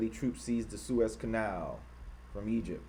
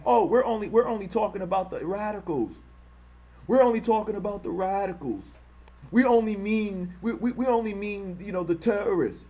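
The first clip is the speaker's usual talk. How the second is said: higher, whiny mock excuse; mocking impression; 7 semitones higher than usual. Streamer mocking bigots who claim to mean only radicals, sarcastic whine. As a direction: parody their fake innocence. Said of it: "as they try to claim".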